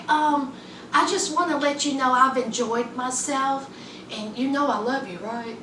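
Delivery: soft, low imitation of a careful confession; gentle preamble. Woman cushioning bad news for her boyfriend, softly careful.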